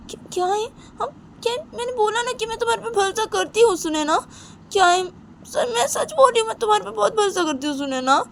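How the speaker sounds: very high, tearful, pleading; high crying voice insisting she trusts; crying plea. Acted. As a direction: sob it out, begging to be believed. Simran cries while pleading.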